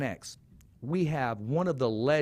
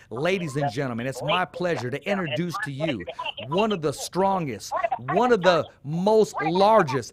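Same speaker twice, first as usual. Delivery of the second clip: ring announcer; 4 dB louder than usual. Shown as a demonstration. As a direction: draw out every word like a fight night. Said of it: "I'm gonna give you a proper introduction".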